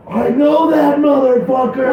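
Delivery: sudden high excited yell; excited shout. Retold or imitated. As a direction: blurt it out in shock. Excited recognition retold to friend.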